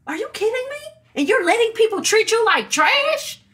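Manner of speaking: outraged disbelief at how they're treated; are you kidding me, said like she can't believe it; indignant outburst. Retold or imitated. Outraged rant at people mistreated.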